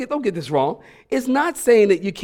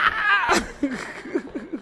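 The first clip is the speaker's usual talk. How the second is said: laughing; 10 semitones higher, 5 dB quieter than usual. Real, not acted. Laughs after the song.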